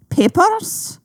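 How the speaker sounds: high, slow, hammed-up German officer accent; mock German accent. Friend teasing the storyteller, in a mock German accent. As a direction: bark it like a cartoon German soldier. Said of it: "I'm trying to do a Nazi accent".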